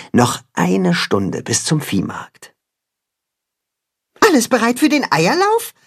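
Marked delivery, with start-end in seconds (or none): 4.2-5.7 much higher eager question; excited question. A narrator's reading. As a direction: ask it all keyed up. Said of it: "fragt Max aufgeregt".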